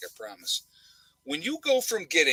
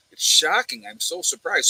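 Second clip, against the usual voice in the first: higher-pitched mock astonishment; sarcastic surprise; 5 semitones higher, 4 dB louder than usual. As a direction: feign shock, dripping with sarcasm.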